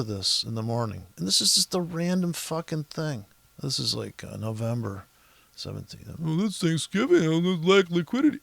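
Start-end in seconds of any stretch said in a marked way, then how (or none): 6.2-8.4 a put-on know-it-all voice, second line rushed x1.4; mock analyst excuses. Trader mocking analysts who blame the holiday, put-on voice. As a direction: parody the pundit's easy excuse.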